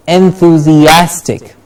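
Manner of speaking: "Enthusiastic" measured but connected; moderately slow, joined-up "Enthusiastic". Shown as a demonstration. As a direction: say "Enthusiastic" carefully in one flow. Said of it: "a little quicker now".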